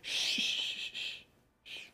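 unvoiced shushing to quiet a crowd; calm the mob with a soft hiss; hushing shh. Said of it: "he's out on the stairs saying".

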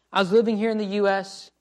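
normal talking voice

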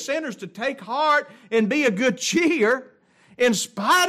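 high, loud pulpit preaching; full-force preaching. Acted. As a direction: preach it out to the congregation.

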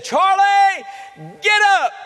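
very high, loud command thrown at the bed; commanding shout. Retold or imitated. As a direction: call him up as if he must obey. Mother commands dead son rise.

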